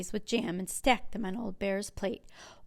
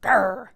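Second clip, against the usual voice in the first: bear growl; 6 dB louder than usual. A narrator's reading. Bear grumbles at rebuke.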